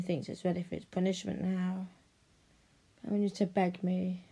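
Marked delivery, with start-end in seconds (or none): none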